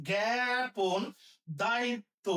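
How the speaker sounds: ordinary talk, nothing marked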